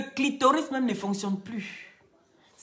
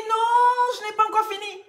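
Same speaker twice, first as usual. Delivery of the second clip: acted loud protest; 13 semitones higher, 6 dB louder than usual. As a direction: cry out as the indignant patient. Patient protests in retold joke. (protestation criée, suraiguë, jouée)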